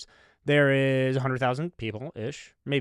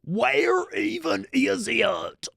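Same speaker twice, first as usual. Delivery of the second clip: much higher, very slow, slurred and beer-soaked; wasted drunk voice; 11 semitones higher, much slower than usual. Retold or imitated. Drunk fan asking for the bathroom again, hammed-up slur. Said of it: "sounded like he'd had at least fifty-five beers".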